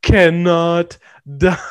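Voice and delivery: slow, loud stilted movie line; mock a bad actor, spacing out every word; hammed-up bad acting. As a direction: overblown word-by-word recital. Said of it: "talks like they're reading words off a page".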